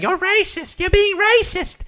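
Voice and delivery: very high-pitched mocking falsetto; Whitney Cummings. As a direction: squeak out a mock accusation. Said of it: "she was just saying".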